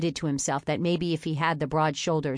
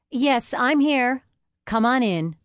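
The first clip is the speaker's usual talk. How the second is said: higher, louder, slow strained welcome; forced cheer; 8 semitones higher, 6 dB louder, slower than usual. A narrator's reading. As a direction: fake a hearty welcome.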